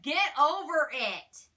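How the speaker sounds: ordinary delivery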